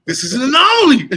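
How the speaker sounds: a high, loud cry of disbelief; shocked outburst. Retold or imitated. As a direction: yelp it like you can't believe it. Retold shock at losing his show.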